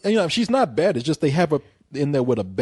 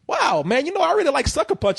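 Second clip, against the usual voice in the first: higher, faster voice, a lightly done stoner; dopey college kid; 10 semitones higher than usual. Retold or imitated. College kid at a party gushing in a lightly put-on, higher voice. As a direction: gush about the film like a dazed partygoer. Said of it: "they're gonna say".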